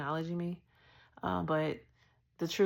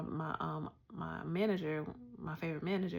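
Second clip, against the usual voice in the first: ordinary talk, nothing marked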